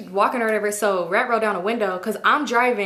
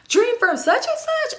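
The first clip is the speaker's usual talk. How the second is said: much higher, drawn-out disbelief; shocked question; 14 semitones higher, slower than usual. Retold or imitated. Friend in back seat recognizes Dream's name, stunned and high.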